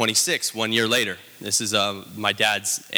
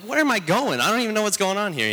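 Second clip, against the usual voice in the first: lost and rattled, pitch soaring; bewildered high cry; 10 semitones higher than usual. Retold or imitated. Rider chased by a fire truck, baffled high-pitched exclamation. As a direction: voice jumps way up, baffled and pleading.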